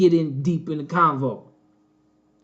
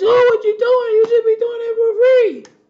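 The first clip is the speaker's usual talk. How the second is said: very high, loud mocking squeal; mocking critic; 6 dB louder than usual. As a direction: mimic a pushy critic in an absurdly high voice. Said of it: "We don't talk like that. It's".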